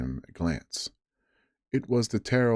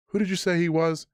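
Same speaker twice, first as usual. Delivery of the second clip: startled question; 11 semitones higher, faster than usual. Acted. Silver suddenly alarmed.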